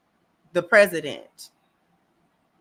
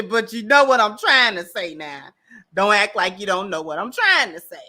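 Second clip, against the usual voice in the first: usual speaking voice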